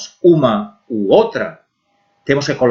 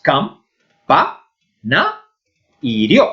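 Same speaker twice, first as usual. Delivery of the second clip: cam, pa, na, rio as separate beats; syllable by syllable; slower than usual. Shown as a demonstration. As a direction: break it up with a pause after cam, pa and na. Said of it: "está formada pelas sílabas".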